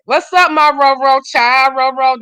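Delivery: loud, slow, drawn-out hello; excited greeting. Real, not acted. Host greeting Roro as he joins, a loud drawn-out welcome. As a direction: welcome him in with a big call.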